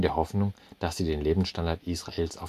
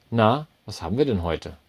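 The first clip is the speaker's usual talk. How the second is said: forced breeziness; 4 semitones higher, 6 dB louder than usual. A narrator's reading. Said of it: "mit betonter Leichtigkeit".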